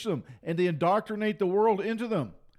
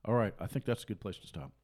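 much softer and lower closing; quiet sign-off; 9 semitones lower, 7 dB quieter than usual. Real, not acted. Preacher closing the sermon, soft low sign-off. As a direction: wind down calmly. Signing off.

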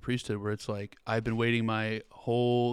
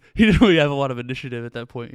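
excited laughing; 2 semitones higher, 14 dB louder, faster than usual. Real, not acted. Laughing over the paralytic joke.

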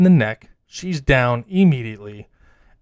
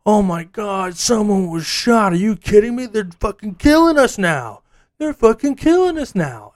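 panicked outrage; 8 semitones higher, 3 dB louder than usual. Retold or imitated. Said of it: "they're like".

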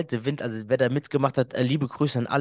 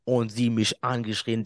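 usual speaking voice

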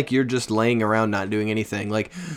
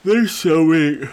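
words stretched by a yawn; 6 semitones higher, 5 dB louder than usual. Real, not acted. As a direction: say it mid-yawn. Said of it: "I'm yawning".

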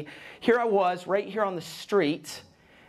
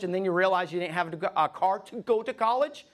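normal talking voice

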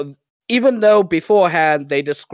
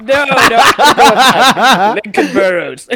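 slow, loud and high, laughing through it; 6 semitones higher, 8 dB louder, slower than usual. Real, not acted. Correcting a cohost's wrong guess, laughing loudly. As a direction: laugh out the denial, dragging each no.

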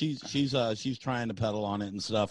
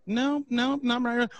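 high mock voice; 10 semitones higher, slower than usual. Arguing, quoted in a higher voice.

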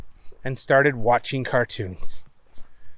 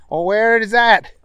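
a bit higher character voice; Booger voice; 6 semitones higher than usual. Acted. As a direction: raise the voice for Booger.

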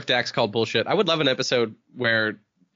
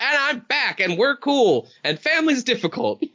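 high mock sitcom; 10 semitones higher, slower than usual. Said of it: "They're supposed to be like".

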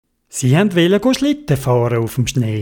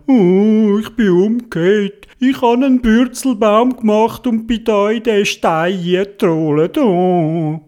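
higher, wailing small-bear voice; moaning little bear; 5 semitones higher than usual. Acted. Little bear explains its tumble to Theobald, plaintive moan. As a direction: groan it out plaintively, stretching the "oh". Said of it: "Und der Bär hat gesagt".

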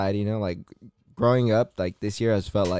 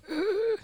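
choked gasp; 4 dB quieter than usual. Retold or imitated. Acting holding in a cough.

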